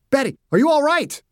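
urgent, high-pitched check on someone hurt; worried calling out. A narrator's reading. Father to his daughter after her crash, worried. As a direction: call out in alarm to an injured child.

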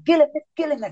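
high-pitched retold crowd cry; urgent chant without real volume; mock yell. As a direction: imitate onlookers egging on a fighter, pitched high. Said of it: "los sangres le gritaban".

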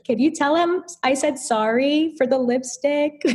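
high-pitched, sheepish retold plea; imitating your own guilt-ridden request; guilty apology. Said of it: "feeling so guilty".

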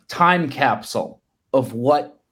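normal talking voice

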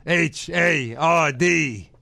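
drawn-out spelled letters; spelled-out promo. Retold or imitated. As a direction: spell it out like a wrestler. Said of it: "I'm gonna spell it out".

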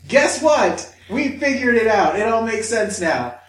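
high, loud, excited reveal; eager mock report, starting at a yell; triumphant announcement. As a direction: shout the discovery to the boss. Acting out brain department's announcement.

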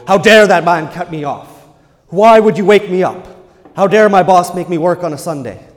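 loud, high indignant roar of outrage; angry bear. Retold or imitated. Preacher imitating a defensive bear-like person, loud angry outburst. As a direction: bellow it like a woken bear, furious and offended. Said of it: "more defensive like a bear".

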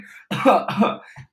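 high, loud mock coughing; sharp pretend coughs; imitated choking cough. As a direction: act out choking on something. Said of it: "coughing and choking".

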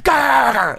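high, drawn-out snarling bark; imitated dog bark. Storyteller imitating the dog that barked at him, high bark. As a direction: imitate a dog going off at someone. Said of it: "And her dog just goes".

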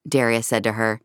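ordinary delivery

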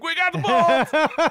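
high, loud, slow and gleeful; triumphant cheer. Retold or imitated. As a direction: crow it like you just won. Cheering at the boat news.

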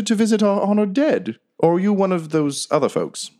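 a courteous creature introducing itself; polite badger. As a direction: badger introducing himself to Quinny, high and proper.

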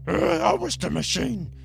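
normal talking voice

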